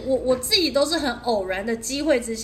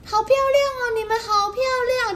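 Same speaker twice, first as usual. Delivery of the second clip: much higher, sing-song praise; gushing neighbors; 12 semitones higher, 3 dB louder than usual. Retold or imitated. Imitating rural neighbors praising the visitors, high and cooing. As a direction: coo like doting old neighbors. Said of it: "然后他们都会说".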